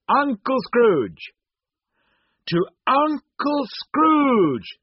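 high, loud, drawn-out toast; cheerful cry. A narrator's reading. Friends all toasting Uncle Scrooge, crying out cheerfully. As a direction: cheer it out like a whole party raising glasses. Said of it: "they all cried".

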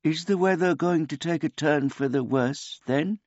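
put-on high, polite old man voice; a gentle, childlike old man; simple old man. A narrator's reading.

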